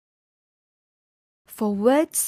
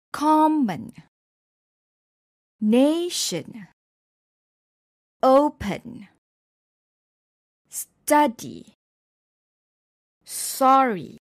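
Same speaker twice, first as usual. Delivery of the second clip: COMmon, NAtion, Open, STUdy, SORry, each opening punched; stress on first syllable; 3 semitones higher, slower than usual. Shown as a demonstration. Teacher giving examples, first syllables stressed in "common" to "sorry". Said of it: "the stress is usually on the first syllable".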